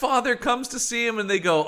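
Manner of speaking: ordinary talk, nothing marked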